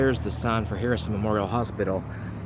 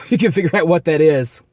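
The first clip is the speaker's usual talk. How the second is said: loud laugh, louder and higher; 8 semitones higher, 13 dB louder than usual. Real, not acted. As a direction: laugh it out at yourself. Laughing at his own bad hint.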